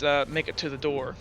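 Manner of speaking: ordinary talk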